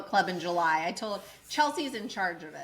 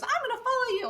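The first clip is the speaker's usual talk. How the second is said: eager promise; 14 semitones higher, faster than usual. Retold or imitated. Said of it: "She goes".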